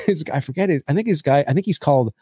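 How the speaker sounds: ordinary talk, nothing marked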